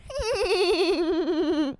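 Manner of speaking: high, long quavering giggle; imitated character laugh. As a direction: trill out a wavering cartoon laugh. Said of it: "That's how she laughs".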